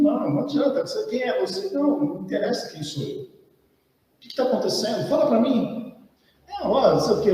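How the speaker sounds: put-on high, fed-up boy brushing off a stranger; a sulky kid who wants to be left alone; irritated boy. Annoyed boy snapping at the stranger who questions him.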